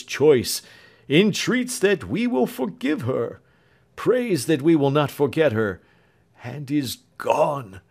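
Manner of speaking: the doctor telling Alfred his daughter has fled; broken voice. Acted. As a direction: let the voice break with grief. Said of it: "said the doctor in a broken voice".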